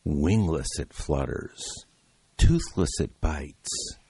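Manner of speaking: slow, low, breathy-free sultry read; sexy dramatic voice. Acted. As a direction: lay on a slow sultry superhero voice.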